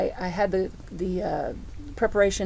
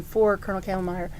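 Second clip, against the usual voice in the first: ordinary delivery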